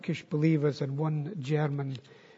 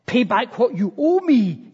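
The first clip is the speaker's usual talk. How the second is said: loud, high angry demand; forceful demand; 7 semitones higher, 10 dB louder than usual. A narrator's reading. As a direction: demand it loudly, pitched well up. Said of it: "he demanded".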